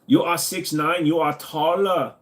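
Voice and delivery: light mock German accent, w turned to v; hammed-up German, slow and proud. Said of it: "We are German".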